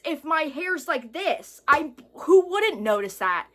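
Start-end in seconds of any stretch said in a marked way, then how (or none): none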